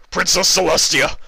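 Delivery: loud, high panicked shout; guard galloping in, hollering in panic. Acted. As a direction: holler for her across the square. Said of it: "the guard hollered in panic".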